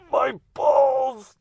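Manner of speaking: very high, strangled squeak; squeaky mouse voice. Retold or imitated. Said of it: "I sounded like a cartoon mouse".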